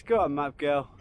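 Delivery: normal talking voice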